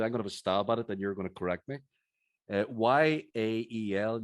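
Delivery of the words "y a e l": slow letter-by-letter spelling of Y A E L; careful spelled-out Y, A, E, L. Real, not acted. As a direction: say Y A E L slowly, one letter at a time. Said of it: "I'm gonna spell it to you".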